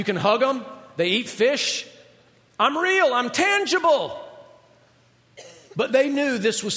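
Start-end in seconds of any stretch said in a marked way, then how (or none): 2.6-4.2 raised pitch, drawn-out last word; acted, emphatic proof of being real; emphatic insistence. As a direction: insist on it, voice lifted high.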